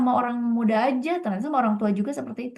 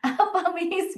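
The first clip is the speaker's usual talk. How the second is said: higher, bewildered query; puzzled question; 8 semitones higher, much slower than usual. Retold or imitated. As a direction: ask as if caught off guard. Student's confused reply.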